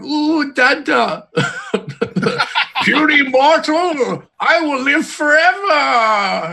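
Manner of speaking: put-on high, slow childish cosmic boast; baby god voice. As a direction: coo then proclaim forever. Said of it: "you should be going".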